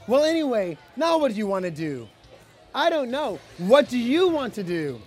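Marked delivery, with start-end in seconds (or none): none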